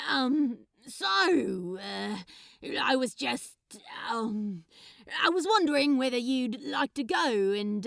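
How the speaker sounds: loud and halting, full of ums; nervous Crowley. Acted. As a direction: screw up the courage to ask. Crowley nervously asking him out.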